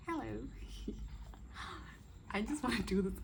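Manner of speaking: ordinary delivery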